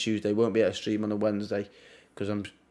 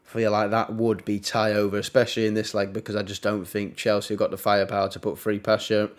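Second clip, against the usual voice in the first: ordinary delivery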